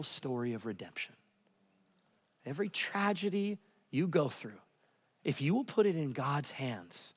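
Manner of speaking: soft preaching. Acted. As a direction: draw them in quietly.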